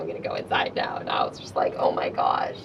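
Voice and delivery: whispered under the breath; hushed escape whisper. Retold or imitated. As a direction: sneak the words out in a hush. Sneaking away from the crowd.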